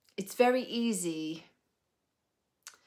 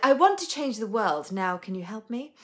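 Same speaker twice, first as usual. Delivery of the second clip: mock grand claim; 5 semitones higher, 9 dB louder than usual. Quoting herself grandly.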